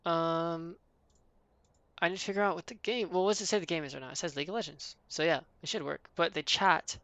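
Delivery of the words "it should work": usual speaking voice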